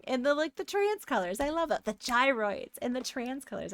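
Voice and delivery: ordinary talk, nothing marked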